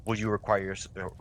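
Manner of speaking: normal talking voice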